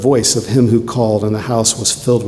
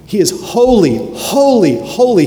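exalted proclamation; 8 semitones higher than usual. Acted. Preacher to congregation, proclaiming in exalted high voice. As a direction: proclaim it like the angels in awe.

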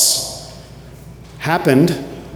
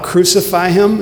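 raised, higher mock crowd shout; crowd's cry; 6 semitones higher than usual. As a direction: call it out like the mob, a touch higher. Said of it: "the crowds cried out".

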